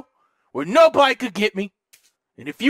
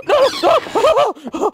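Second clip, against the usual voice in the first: high-pitched startled wail; panicked cry; 9 semitones higher, 3 dB louder than usual. Real, not acted. Startled during horror game.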